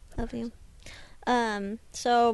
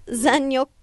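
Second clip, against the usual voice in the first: ordinary delivery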